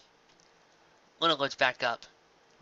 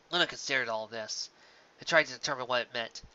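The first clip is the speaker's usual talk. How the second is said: usual speaking voice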